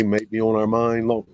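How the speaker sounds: words broken by a burp. Real, not acted. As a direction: say it through a small burp. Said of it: "excuse me, I'm burping".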